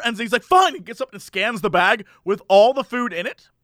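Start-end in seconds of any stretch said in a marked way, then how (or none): 0.4-0.7 higher, louder sharp one-word bark; hammed-up angry customer; mock angry snap. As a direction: spit it out in a huff.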